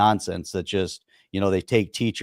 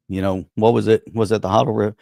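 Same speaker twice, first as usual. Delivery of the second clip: usual speaking voice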